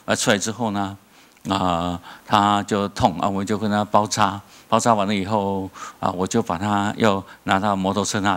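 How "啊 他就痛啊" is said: ordinary delivery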